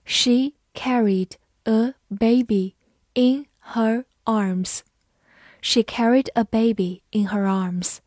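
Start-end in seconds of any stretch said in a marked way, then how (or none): 0.0-4.8 very slow, word by word; slow reading. Shown as a demonstration. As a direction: spell it out slowly for learners. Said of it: "好，慢慢来读。". (很慢地逐词朗读)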